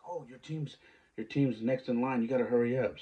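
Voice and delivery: high, loud hurrying call; excited lady waving them over; urgent call. Retold or imitated. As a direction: rush them along, calling out. Lady urging them at picture day.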